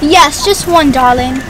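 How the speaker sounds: put-on British accent with endearment. Confirming one cake pop to barista, British accent. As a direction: answer like a British customer. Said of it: "that accent when you order your Starbucks".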